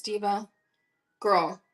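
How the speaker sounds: normal talking voice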